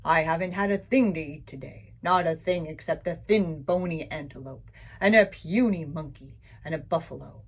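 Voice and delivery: low, loud growling lion voice; lion grumbling about his meagre meals, low and loud; hungry lion. Acted. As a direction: grumble like a big starving cat. Lion complains of hunger.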